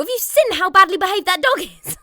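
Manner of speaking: high, loud exasperated rant; mock outrage. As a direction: act out the scandalised reply to everyone. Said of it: "And I was like".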